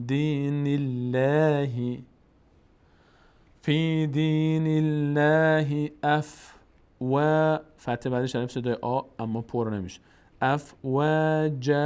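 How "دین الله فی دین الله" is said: الله with a thin l, slow chant; slow دین الله, thin lam; light Allah. Shown as a demonstration. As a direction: keep the lam of الله slender. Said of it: "باریک".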